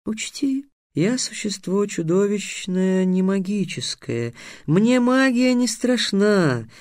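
high, slow, self-important warning; somewhat agitated sphinx. A narrator's reading. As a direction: draw out the boast uneasily. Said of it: "несколько взволнованно начал сфинкс".

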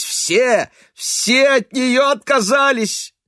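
high, loud distraught mother; a distraught mother, near shouting; agitated mother. A narrator's reading. Mother's bitter agitated speech.

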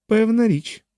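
a high-pitched, brief assurance; assuring woman. A narrator's reading. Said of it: "запевнила Анжела". (жіноче запевнення високим голосом)

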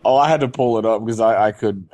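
normal talking voice

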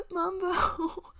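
high, loud sobbing call of a name; sobbing cry. Acted. Green clinging to Mumbo, sobbing his name. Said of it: "He almost sobs out".

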